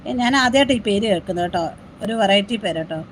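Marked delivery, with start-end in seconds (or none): none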